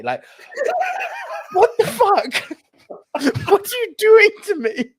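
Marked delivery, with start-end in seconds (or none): 0.8-4.9 high, loud laughing protest; laughing exasperation. Real, not acted. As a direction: beg it to stop through laughter.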